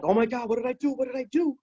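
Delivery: frantic high-pitched pleading, re-enacted; retold panic. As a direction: relive the terror of thinking you hurt someone. Retold panic facing the cops.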